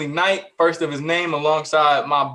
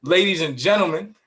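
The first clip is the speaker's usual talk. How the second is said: lucha libre announcer. Acted. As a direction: introduce him like a wrestling champ. Grand host introduction of cohost.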